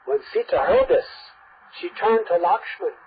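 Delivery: plain reading voice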